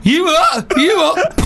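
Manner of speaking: high, laughing taunt; mock brawl taunt. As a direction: egg on a fight. Brawl erupting at bingo.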